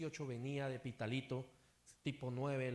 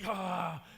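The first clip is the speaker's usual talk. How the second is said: loud sudden "ah"; imitated cry of alarm; acted startled gasp; 5 dB louder than usual. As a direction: gasp loudly as if shocked on the road. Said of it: "un ejemplo así".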